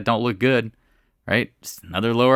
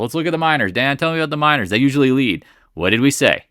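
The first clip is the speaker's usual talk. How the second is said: raised, higher voice, louder toward the end; near yell; 5 semitones higher, 5 dB louder than usual. Real, not acted. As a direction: call it out emphatically, almost yelling. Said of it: "I'm not trying to yell".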